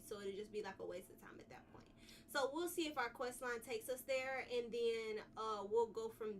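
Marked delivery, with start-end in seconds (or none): 0.0-1.8 quick, hushed aside; fast whisper. Real, not acted. Streamer murmuring to herself about the route, whispered. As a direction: mutter it under your breath, fast.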